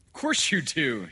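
loud, high, hearty doctor; hearty agreement. Acted. Doctor humouring the pleading father, loudly hearty.

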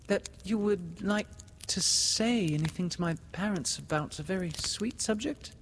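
put-on high, tentative girl's question; faltering. A narrator's reading. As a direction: ask high, feeling your way shyly. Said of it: "she said falteringly".

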